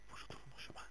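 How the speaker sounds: soft voiceless whisper to himself. Real, not acted. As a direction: breathe it, no voice at all. Muttering to himself while lost. (chuchoté pour soi-même)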